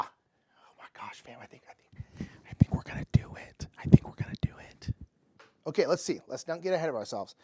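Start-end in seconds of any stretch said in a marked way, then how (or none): 0.0-2.3 tense breathless whisper; excited whisper. Real, not acted. Near winning the game. 2.5-3.7 fast tense whisper; excited whisper. Real, not acted. Near winning the game. 3.8-4.9 faster, stronger whisper, hope rising; louder excited whisper. Real, not acted. Near winning the game.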